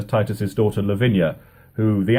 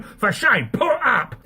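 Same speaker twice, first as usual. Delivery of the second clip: angry shouting. Acted. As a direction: yell your threats at your rival. Prince shouting in a duel.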